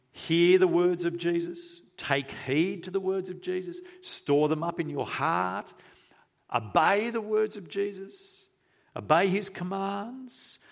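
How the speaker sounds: high, insistent preaching list; urgent exhortation. Real, not acted. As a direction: press each command home, pitched well up. Preacher urging a list of commands.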